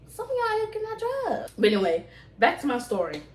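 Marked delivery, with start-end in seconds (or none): 0.1-1.5 high, soft sighing complaint; exasperated sigh. Real, not acted. Scolding bad drivers online, soft high exasperation.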